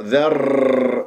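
ذر with the r rolled repeatedly; trilled ra, wrong. Shown as a demonstration. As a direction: trill the r at the end of ذر. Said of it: "ولا تكرر". (ذر بتكرير الراء)

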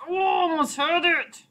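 high, drawn-out wail at a near miss; frustrated cry. Real, not acted. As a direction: cry out high and slow, so close.

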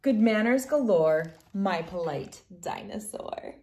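gentle storybook reading voice; reading to children. Acted. Reads picture book aloud.

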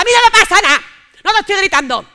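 high, loud jealous yelling; furious yelling. Retold or imitated. Jealous girlfriend yelling at late boyfriend, loud and high. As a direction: yell it while insisting you're not yelling. Jealous quarrel, denying shouting.

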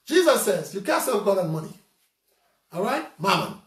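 ordinary talk, nothing marked

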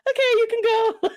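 high, laughing through the guard's line; laughing mock guard. Border guard waving her through, quoted while laughing. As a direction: crack up while quoting the guard.

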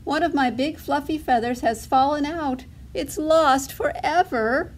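high, plaintive duckling voice; quacking duckling. A narrator's reading. Duckling reports lost feather to mother, quacking. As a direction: lament it high, dragging the ending. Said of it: "Dilly quacked".

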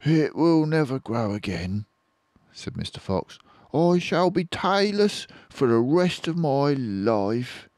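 mildly glum fox; glum Mister Fox. Acted. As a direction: sound sorry for yourself. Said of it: "He looked very glum".

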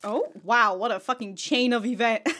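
normal talking voice

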